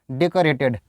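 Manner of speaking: "डेकोरेटेड" as deh-kuh-ray-ted; "डेकोरेटेड" with uh in place of oh; correct pronunciation. Shown as a demonstration. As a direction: say "डेकोरेटेड" with a schwa after k. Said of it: "डेक बोलते हैं".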